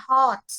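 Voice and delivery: "heart" with a long open ah and no r; "heart" stretched, broad long vowel. Shown as a demonstration. Teacher contrasting "heart" with "hurt". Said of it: "হার্ট বলে না".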